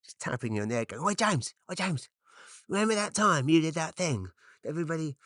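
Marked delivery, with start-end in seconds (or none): 1.0-5.2 higher, needling demon taunt; a pestering inner demon, higher and taunting; little demon voice. Retold or imitated. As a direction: poke at him like a nagging imp. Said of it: "there's this little demon".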